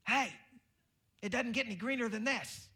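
high, loud frustrated yell at the driver; mock scream. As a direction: shout it out like you're stuck behind them. Screaming at slow driver ahead.

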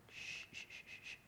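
very soft repeated shushing; soothing shush. Acted. God soothing us like a mother, very soft shushes. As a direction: hush gently, right by the ear. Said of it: "that little noise in the ear".

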